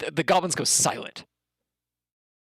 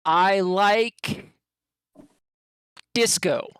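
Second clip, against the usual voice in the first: goblin intro; 5 semitones higher, 5 dB louder, much slower than usual. Acted. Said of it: "you hear the goblin".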